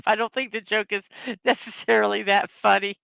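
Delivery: real giggles breaking into the words; helplessly amused, self-mocking; laughing. Real, not acted. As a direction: talk through a laughing fit at your own joke. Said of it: "made me laugh".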